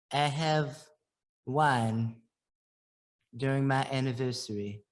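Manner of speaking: put-on friend's British accent, low and shy; imitating British accent. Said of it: "he has a British accent".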